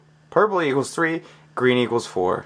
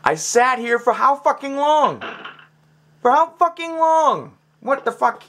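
exasperated shout; 12 semitones higher than usual. Real, not acted. Gamer yelling at the puzzle he wasted time on, furious. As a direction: yell it at the game, fed up.

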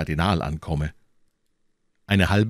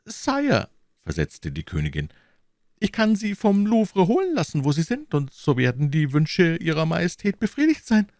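queen voice; 11 semitones higher than usual. Acted. Queen answers the king.

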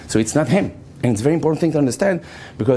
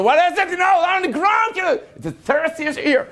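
alarmed guard. Retold or imitated. Security guard bursting in with a gun, high and urgent. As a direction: bark it like a jumpy guard.

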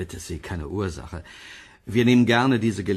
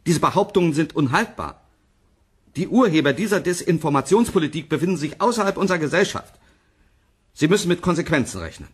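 higher, fast and stern, a warning to critics; veiled threat; 7 semitones higher, faster than usual. Acted. Professor on a TV panel warns the rumour-spreaders: stern, fast threat. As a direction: press the warning home, quickly and firmly.

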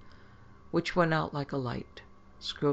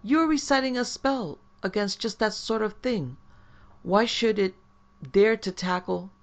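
higher, louder agitated protest; a little wild, higher and louder; 7 semitones higher, 6 dB louder than usual. Acted. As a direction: protest with rising panic. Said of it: "went a little wild in his speech".

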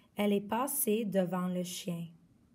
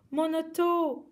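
high, loud dismayed cry; 7 semitones higher, 5 dB louder than usual. Acted. Guy crying out for his runaway car, loud cry. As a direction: wail for the lost toy. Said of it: "a crié Guy".

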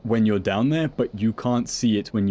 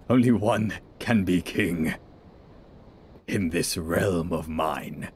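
grandiose villain; 3 semitones lower, slower than usual. Acted. Mock villain boast on cliff.

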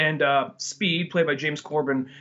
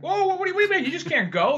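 high-pitched mock alarm; alarmed protest; 13 semitones higher, faster than usual. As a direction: protest in a high, startled imitation. Acted protest at man leaving.

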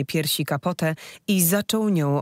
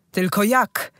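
loud, high outburst; yelled; 5 semitones higher, 4 dB louder than usual. Acted. Husband yells at the wise woman, loud and high. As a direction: fling the question out in a panic. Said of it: "wrzasnął".

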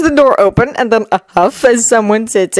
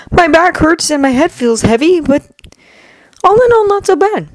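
put-on higher, tired teen voice; young boy voice; 10 semitones higher than usual. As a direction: shrug it off like a kid. Izuku voiced by narrator.

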